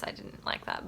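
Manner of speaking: a breathy, unvoiced confession; under-the-breath grumble about the narrator; whispered aside. Real, not acted. Aside on Matilda's voice.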